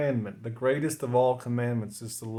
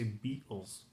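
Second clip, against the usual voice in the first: attempted British accent; 2 semitones lower, 9 dB quieter than usual. Shown as a demonstration. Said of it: "I tried to do the British accent".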